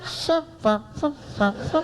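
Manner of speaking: tiny high peeps in short puffs. Retold or imitated. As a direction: squeak out little separate syllables. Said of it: "a girl with a soft voice".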